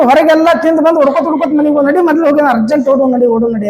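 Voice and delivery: fast, high-pitched hurry; imitating a frantic dash; mock urgency. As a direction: rattle it off like someone rushing home. Mimics urgent rush home.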